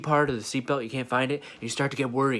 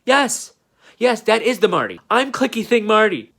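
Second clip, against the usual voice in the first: high, loud, desperate yelp; panicked shouting confession; 6 semitones higher, 6 dB louder than usual. Acted. Marty admitting to the woman he's scared, shouting in panic. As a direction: blurt it out in pure panic, voice cracking up.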